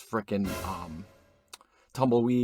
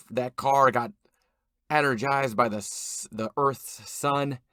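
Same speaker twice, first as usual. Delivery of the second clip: usual speaking voice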